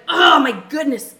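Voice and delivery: a small burst of frustration, drawn out; minor outburst. Retold or imitated. Wife exasperated over the oven rack, loud slow exclamation. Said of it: "it wasn't much of an outburst".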